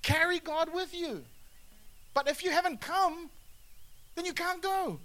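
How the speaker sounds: high, ringing preacher's cry; preaching. Acted. Preacher proclaiming to the church, high and ringing. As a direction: proclaim it to the congregation.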